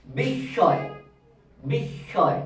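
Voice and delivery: বিস্ময় said as bish-shoy, with a doubled sh; silent ম. Shown as a demonstration. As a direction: say বিস্ময় slowly with a long hissing sh and no m. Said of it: "এটার উচ্চারণ হবে বিস্ময়".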